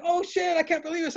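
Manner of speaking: high, squeaky disbelief; cartoon shock at the mishap; hammed-up high-pitched dismay; mock disbelief. [name] bumps Santana in.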